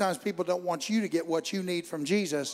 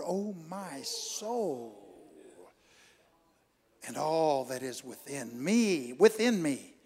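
slow, reverent psalm quote; 3 semitones lower, slower than usual. Real, not acted. Slow hymn-like quotation.

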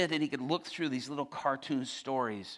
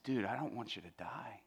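tender concern; 2 semitones lower, 7 dB quieter than usual. Retold or imitated. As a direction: quietly show a friend you care. Pleading with inmate he loves.

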